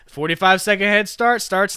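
chef's announcement. Retold or imitated. Chef telling the men their head start begins, lightly hammed-up. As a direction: announce it like a mildly menacing host.

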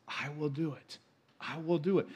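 gentle echo of the promise; soft emphatic repeat. Shown as a demonstration. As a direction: let the promise land quietly. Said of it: "he repeats himself for emphasis".

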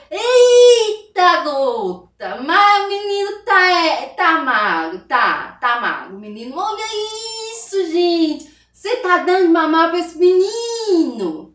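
put-on high, loud shocked old healer; rezadeira's exclamation. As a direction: cry out in alarm at the thin baby. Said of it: "olhou pra criança e falou".

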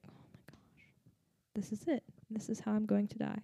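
scared, whispered "this is how I'm going to die". Retold or imitated. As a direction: hush and fear as the light goes out. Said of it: "I was like".

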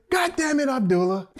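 high, exasperated mock yell; a fed-up coach cursing his player. Special teams coach yelling.

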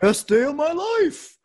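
high, slow, over-bright "best day" voice; fake cheerful person. Friend asked about his day, answering in hammed-up fake cheer. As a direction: ham up the forced cheer. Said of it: "you know the one who's like".

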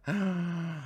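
high shocked gasp; dramatic gasp. Acted. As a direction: gasp in mock horror. Reacting to friendzone story.

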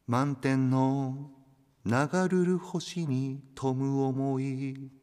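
slow, measured poem reading; poem recitation. Acted. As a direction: savour each phrase slowly. Reads a listener's tanka aloud. (ゆっくり朗読する詩)

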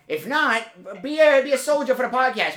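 high-pitched, insistent exhortation; mock rallying tirade. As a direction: urge the absent guest on like a recruit. Mock tirade at absent guest Joe.

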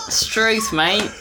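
mock Aussie accent; Australian accent. Co-host joins the Australian forfeit, Australian accent. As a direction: chime in with a cartoon Australian accent.

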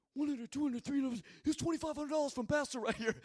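high mafia-guy voice counting out cash; Paul. Retold or imitated. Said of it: "this is how he talks".

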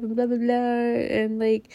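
ordinary delivery

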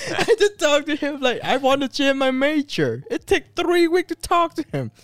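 loud, very high-pitched complaint; real exasperation. Real, not acted. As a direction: protest at the top of your range, worked up. Exasperated complaint over friend's laughter.

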